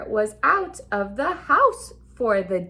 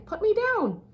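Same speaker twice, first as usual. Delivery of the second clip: "Put me down" jumps much higher, a mild mock yell; fish yelling; 8 semitones higher than usual. Fish yelling at the cat, high mock yell. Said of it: "the fish is yelling at him".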